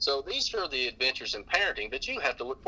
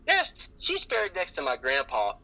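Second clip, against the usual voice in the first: put-on cheery little girl; 10 semitones higher than usual. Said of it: "And she said".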